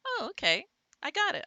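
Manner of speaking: high, loud mock eureka; pretend realization. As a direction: play a learner suddenly getting it. Acts reader who decoded the word.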